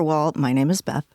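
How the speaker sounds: ordinary delivery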